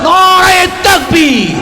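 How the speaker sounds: high, long-drawn rallying shout; full-force slogan shout. Real, not acted. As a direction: stretch the slogan out high over the crowd. Repeats slogan, bigger call.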